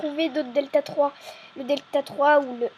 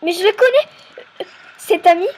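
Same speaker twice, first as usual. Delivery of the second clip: surprised; 4 semitones higher, 6 dB louder than usual. Acted. Recognising a friend in roleplay.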